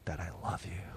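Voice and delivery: very soft, earnest declaration of love; husband quietly and solemnly telling his wife he loves her; serious and sincere. Retold or imitated. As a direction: look her in the eye and mean it. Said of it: "Wow, so serious".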